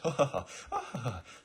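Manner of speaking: high put-on social laugh; chummy laughter. Girl and big guy sharing a joke, chummy fake laughter. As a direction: laugh along casually. Characters share casual laugh.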